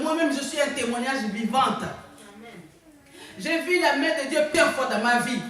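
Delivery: high, loud preaching at full force; fervent preaching. Real, not acted. Preacher testifying to the church about God's healing, fervent and loud. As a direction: drive each line home to the congregation.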